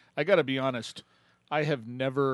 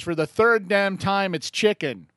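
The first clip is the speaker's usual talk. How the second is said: higher, louder and slow, fed-up snap; exasperated wife; 8 semitones higher, 8 dB louder, slower than usual. Retold or imitated. Wife snaps back at her husband about dinner. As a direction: spit it out, sick of being asked. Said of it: "And she goes".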